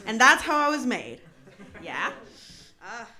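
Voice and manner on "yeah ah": ordinary talk, nothing marked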